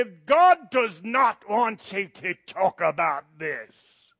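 loud and slow, preachy; moralistic scold. Retold or imitated. Pastor mocks a moralistic reading of Scripture, in a slow loud scold. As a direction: intone it like a stern moralizer. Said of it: "moralistic looking at Scripture can say".

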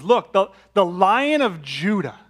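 high, loud urgent call; urgent elder. Acted. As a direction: call out to make him look. Voicing the elder's announcement.

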